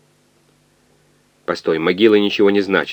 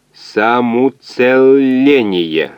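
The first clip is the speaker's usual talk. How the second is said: very slow, drawn-out machine utterance, higher pitched; бурчание калькулятора; 5 semitones higher, 6 dB louder, much slower than usual. A narrator's reading. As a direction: stretch every syllable like a talking calculator. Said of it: "пробурчал калькулятор". (калькулятор тянет слово по слогам)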